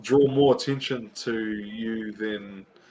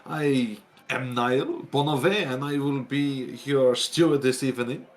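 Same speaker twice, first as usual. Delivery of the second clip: stiff, formal butler with a French touch; upright steward; 2 semitones higher than usual. Acted. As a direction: greet guests like a proud manor steward. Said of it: "comes out really upright and says".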